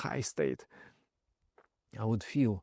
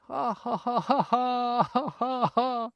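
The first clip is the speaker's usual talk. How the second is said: high, giddy laughing ha ha; drunken laughter; 11 semitones higher, slower than usual. Retold or imitated. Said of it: "And I'm laughing".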